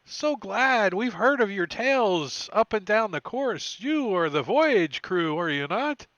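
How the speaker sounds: loud, high, welcoming ship captain; hearty sailor voice. Acted. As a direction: boom out a jolly sailor's greeting. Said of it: "they're like".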